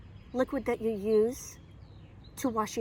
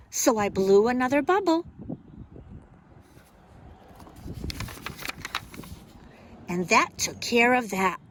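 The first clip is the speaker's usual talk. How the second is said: lively, expressive picture-book reading; reading to children. Acted. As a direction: read it like storytime for little kids.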